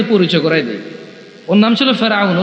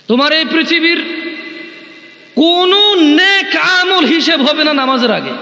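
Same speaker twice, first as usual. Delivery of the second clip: slow emphatic preaching; 7 semitones higher, slower than usual. Acted. Preacher stressing prayer's priority.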